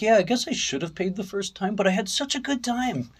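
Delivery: high-pitched, German-accented imitation. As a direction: imitate a happily unrepentant German friend. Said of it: "he's got that German accent".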